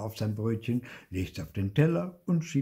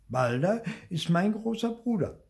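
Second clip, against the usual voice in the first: put-on higher child voice, earnest; little boy voice; 12 semitones higher, 4 dB louder than usual. A narrator's reading. Theo telling his parents about his brother, high child voice. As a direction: play the small boy Theo.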